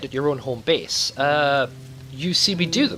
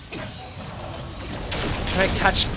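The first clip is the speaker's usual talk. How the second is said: whispered in suspense; tense whisper; 5 semitones higher, much slower than usual. Real, not acted. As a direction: hold your breath and whisper it. Tense moment catching a grenade.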